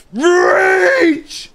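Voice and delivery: high, loud straining shout; straining scream. Real, not acted. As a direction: scream it, stretching for the ledge. Straining to reach ledge.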